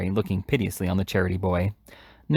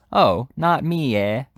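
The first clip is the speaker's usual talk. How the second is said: sneering; 4 semitones higher, 4 dB louder, slower than usual. A narrator's reading. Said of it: "sneered Noah".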